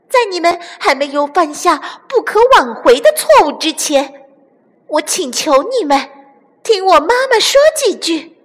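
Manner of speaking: put-on high, loud young voice; high, urgent childlike appeal; brave pleading chick. A narrator's reading. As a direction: plead earnestly with the enemy, mustering courage.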